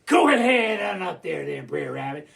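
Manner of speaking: growling, rasping wolf voice; wolves. Acted. Wolves sending Brer Rabbit up to bed, growling. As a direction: growl it out with claws up. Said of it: "so they all went".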